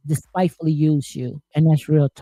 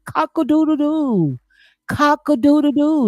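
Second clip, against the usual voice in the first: rooster crow; 8 semitones higher than usual. Pretend. Host mocks a couple's karma with a rooster crow. Chickens coming home to roost.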